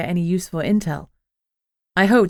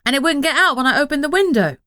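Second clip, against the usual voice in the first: higher and louder, protesting his case; defensive; 9 semitones higher, 4 dB louder than usual. A narrator's reading. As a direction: insist on it, voice climbing in pitch. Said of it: "he said defensively".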